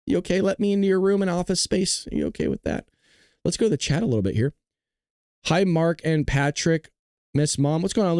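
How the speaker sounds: ordinary talk, nothing marked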